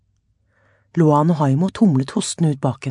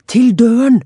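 urgent call, raised and louder; shouting; 7 semitones higher, 7 dB louder than usual. A narrator's reading. Luan rallying friends in the fire, loud shout. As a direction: yell the way out to the others. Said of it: "ropte Luan".

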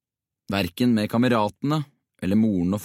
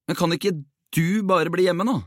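insistent, higher-pitched plea; headstrong; 9 semitones higher than usual. A narrator's reading. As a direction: push the idea stubbornly, pitched up. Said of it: "sa Jonas egenrådig". (egenrådig og pågående)

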